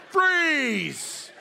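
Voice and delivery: high, drawn-out cartoon gunslinger yell; Yosemite Sam. Wife waving finger guns, hollered like a cartoon cowboy. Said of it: "She's like Yosemite Sam".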